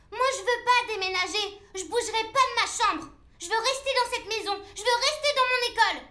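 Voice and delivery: put-on high-pitched child's tantrum; angry protest. Child refusing her parents' house move, angry. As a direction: refuse hotly, pitch climbing. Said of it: "tu ne peux pas crier comme ça".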